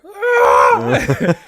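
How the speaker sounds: high loud scream cracking into laughter; laughing mock scream. Thrown goblin screaming as he flies, laughing. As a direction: yell like a man flung through the air, laughing.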